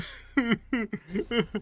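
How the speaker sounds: high, hammed-up sobs; mock sobbing. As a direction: blubber in a high pretend cry. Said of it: "and crying into".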